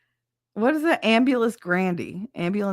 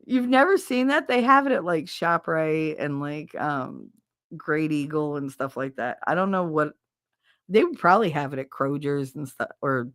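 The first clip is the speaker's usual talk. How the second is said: normal talking voice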